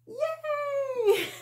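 loud, high squealed cheer with a laugh; cheering yay. Shown as a demonstration. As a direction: cheer it out for the kids to copy.

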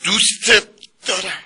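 دوستت دارم belted out high and loud. Pretend. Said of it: "بگو دوستت دارم با صدای رسا". (دوستت دارم را بلند و زیر فریاد بزن)

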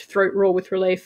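ordinary delivery

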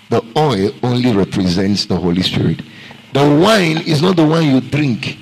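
usual speaking voice

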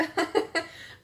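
high breathy giggle. Real, not acted. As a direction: giggle off the teary moment. Laughs after joking about crying.

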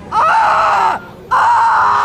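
high-pitched scream of fright; scream, scared on a thrill ride; long, high scared "Ah"; real terrified scream. Real, not acted. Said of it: "for screaming".

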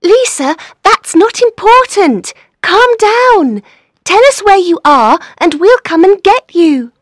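high, loud, worried urging; anxious, insistent phone talk; urgent insistence. Acted. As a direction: press a panicking friend to focus. Friend urges crying caller to focus.

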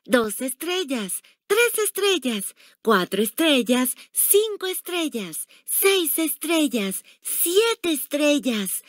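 put-on high-pitched child counting star by star; eager schoolboy. A narrator's reading. Narrator voices counting schoolboy.

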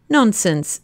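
plain reading voice

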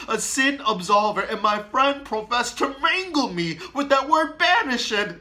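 loud, high, accusing outburst; anguished anger. Acted. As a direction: rail at the friar in grief. Romeo rails at the friar.

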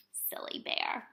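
soft playful whisper; whispered aside. Real, not acted. Reader remarks to children on Bear's idea, whispered aside. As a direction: whisper it confidingly to little listeners.

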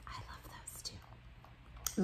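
slow, very soft whisper; whispered aside. Real, not acted. Reader confiding a love of latkes to listeners, whispering. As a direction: drop to a real whisper.

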